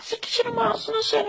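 fast shrill falsetto curse; mother shrieking insults quickly; turkish cartoon dub. Falsetto mother curses, shout heard.

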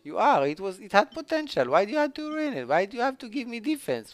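very high, exasperated wailing tirade; outraged rant. Real, not acted. Gamer to miHoYo after defense ruined it, outraged rant. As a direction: start with a yell, keep complaining up high. Outburst at getting defense again.

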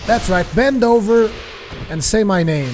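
loud, high gloating yell; taunting shout. Acted. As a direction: crow over the beaten foe. Taunting enemy during a kill.

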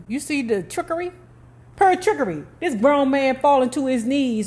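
high, scornful disbelief; mocking. Real, not acted. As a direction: sneer at the trick. Mocking the staged crying.

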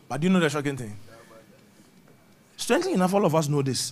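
ordinary talk

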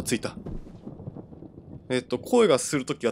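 ordinary talk

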